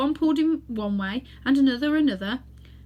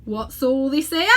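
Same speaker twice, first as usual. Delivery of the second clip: policeman; 4 semitones higher, 5 dB louder, slower than usual. Acted. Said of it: "said the most important of the policemen".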